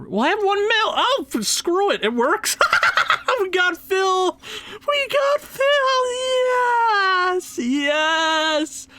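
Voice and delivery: very high, shouting with joy; ecstatic shout. Real, not acted. Gamer finally signing his idol, ecstatic screaming. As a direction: scream it like the cup was won. Signs Phil, celebrates.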